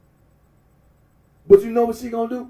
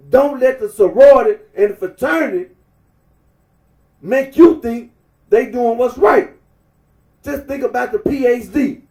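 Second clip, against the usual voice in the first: emphatic rant; 7 dB louder than usual. Real, not acted. Preaching rant at the viewers.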